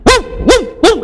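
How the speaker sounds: high yelping woo woo like a barking dog; playful dog-howl imitation; imitated dog barking. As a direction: bark out the woos high, like the neighborhood dogs. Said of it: "of barking".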